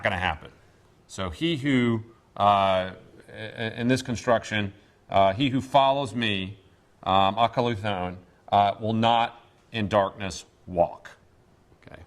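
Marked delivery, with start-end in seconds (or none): 5.3-11.1 slow, weighty word-by-word rendering; a slow, solemn promise; emphatic negative. Shown as a demonstration. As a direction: stretch out will not in darkness walk. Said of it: "So this is a very emphatic negative".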